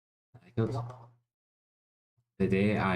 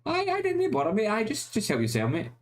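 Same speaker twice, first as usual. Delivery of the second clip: much higher hammed-up voice, lightly put on; mock polite voice; 10 semitones higher than usual. To his mates, offering help in a much higher mock voice. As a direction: play the overly polite mate, pitch way up.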